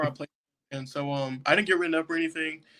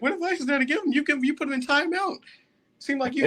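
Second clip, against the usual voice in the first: 1 mock fiance voice; 10 semitones higher than usual. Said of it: "She was like".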